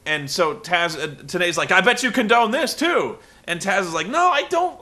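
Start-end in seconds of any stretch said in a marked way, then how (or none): 1.7-3.2 mock accusation. Tenay accuses Taz. 4.1-4.8 mock squeaky denial. Taz denies the accusation.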